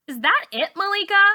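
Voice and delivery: taunting. A narrator's reading. Char taunts Malika.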